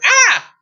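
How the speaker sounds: high, loud cry of dismay; short panicked shriek; hammed-up princess scream; horrified scream.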